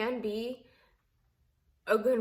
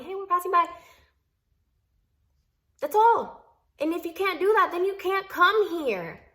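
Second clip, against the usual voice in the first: raised and higher, hammering the rule home; emphatic retold demand; 7 semitones higher, 5 dB louder than usual. Retelling her husband laying down the rule to his mother, raised and firm. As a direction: lay down the law, voice up.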